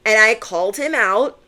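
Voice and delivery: usual speaking voice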